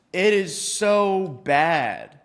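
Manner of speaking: a long, dragged-out complaint; exasperated drawl. Real, not acted. Screen share keeps failing.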